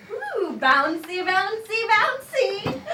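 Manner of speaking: squealing with delight while bouncing on the bed; giddy excitement. Acted. Woman bouncing on a hotel bed, giddy and squealing.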